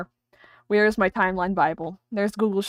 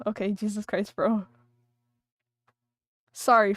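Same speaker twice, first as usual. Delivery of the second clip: ordinary talk, nothing marked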